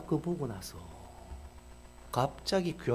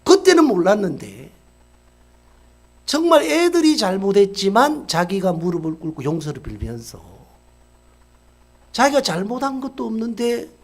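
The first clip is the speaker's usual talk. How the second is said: loud, driving sermon voice; full-force preaching; 2 semitones higher, 10 dB louder than usual. Real, not acted. Preacher drives home the lesson to the congregation, preaching loudly. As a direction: preach it out to the hall.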